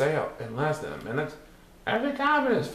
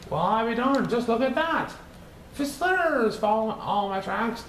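amazed friend; 8 semitones higher than usual. A narrator's reading.

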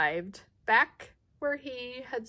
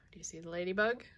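ordinary delivery, nothing marked

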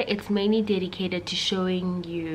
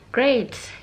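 sarcastic; 6 semitones higher, 6 dB louder, slower than usual. Retold or imitated. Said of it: "they said".

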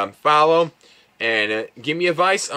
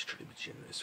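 breathy whisper to himself; whispered aside; 22 dB quieter, faster than usual. Real, not acted. Aside while deciding the rating.

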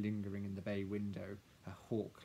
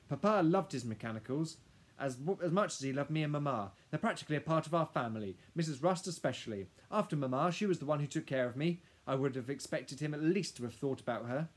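put-on higher, louder, upset girl; earnest young Lily; 6 semitones higher, 8 dB louder than usual. Lily protesting to the lawyer about Papa, earnest. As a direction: plead the case for beloved family members.

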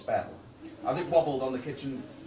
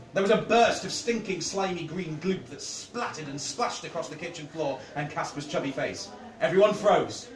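usual speaking voice

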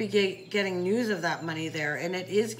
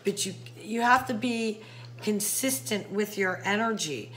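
ordinary talk

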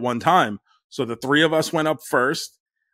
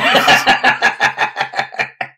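loud laugh; 7 semitones higher, 6 dB louder than usual. Real, not acted. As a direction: burst of loud wheezy laughter. Laughing at own story punchline.

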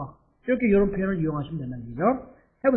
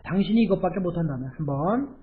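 ordinary talk

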